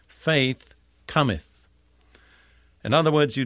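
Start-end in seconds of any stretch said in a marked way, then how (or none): 0.1-1.7 Faith cometh said very slowly, each word weighted; emphasis on Faith cometh. Shown as a demonstration. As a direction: let Faith cometh leap out, slow and deliberate. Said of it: "two words that leaped out at me".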